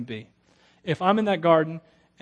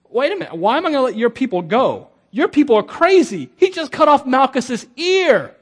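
loud, high indignant guard; mock outrage; 6 semitones higher, 9 dB louder than usual. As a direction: protest like a guard who can't believe it. Imagined guards protesting.

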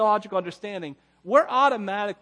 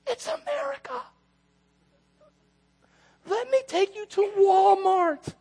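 grandma voice; 4 dB louder than usual. Retold or imitated. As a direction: squeak it like a stubborn abuela. Grandma voiced, hoarding food.

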